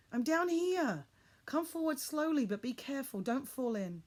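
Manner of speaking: loud high call easing to caution. A narrator's reading. Father in the pit guiding his son toward the edge, calling up. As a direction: call up, then warn carefully.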